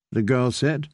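ordinary delivery, nothing marked

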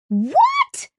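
very high, squeaked "What?"; shocked cry. A narrator's reading. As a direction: cry out in utter disbelief, pitch leaping up. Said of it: "Kizzy cried".

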